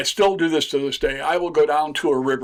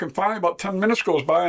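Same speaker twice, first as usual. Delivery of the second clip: ordinary delivery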